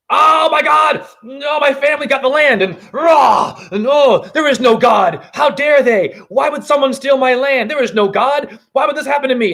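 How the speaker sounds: high, loud, outraged yelling; outraged ranting relative. Acted. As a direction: rant like a man robbed of his land. Acted ranting relative losing land.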